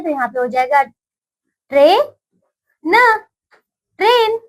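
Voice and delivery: put-on spelling out a word for children; sounded out. Shown as a demonstration. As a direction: split ट्रेन into ट्रे and न, then join it. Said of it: "हम इसे मिलाकर लिख देते हैं".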